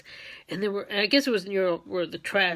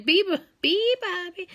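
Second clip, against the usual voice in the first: high chirpy bird call; high mimicked birdsong; bird call imitation; 9 semitones higher than usual. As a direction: sing it like a little bird. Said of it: "they always sound like they're saying".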